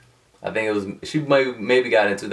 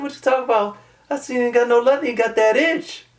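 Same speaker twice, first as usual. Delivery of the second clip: very high retold ribbing, attempted New Orleans accent; mock New Orleans banter; faster than usual. Friend mocking missing leather seats, mock New Orleans accent. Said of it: "I cannot do a New Orleans accent".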